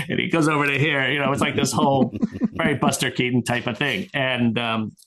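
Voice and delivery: ordinary talk, nothing marked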